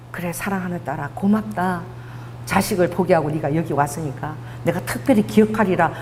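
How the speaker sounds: whisper-soft blessing to a beloved daughter; tender fatherly answer. Pretend. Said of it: "나는 어떤 그런 대답을 기대했어".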